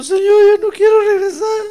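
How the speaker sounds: hammed-up tearful whine, pitched high; mock near-sobbing complaint, high and strained; almost crying. Peter telling the Lord he caught nothing, mock almost crying. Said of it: "Yo me imagino a Pedro ya casi llorando".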